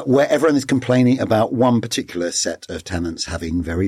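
ordinary talk, nothing marked

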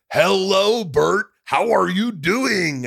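loud, high, drawn-out mock Mexican accent; hammed-up singsong Mexican greeting, pitched high; exaggerated Mexican accent. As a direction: lay the Mexican accent on thick, stretching every word. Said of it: "someone Mexican was like".

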